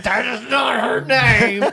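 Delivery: high, slow, laughing protest; indignant child. Retold or imitated. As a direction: mimic an outraged little girl. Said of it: "Evie was like".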